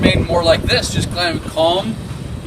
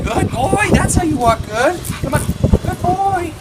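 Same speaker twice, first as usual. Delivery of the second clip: much higher praise to the dog; praising dog; 12 semitones higher, slower than usual. Real, not acted. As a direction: lift the voice way up to reward him.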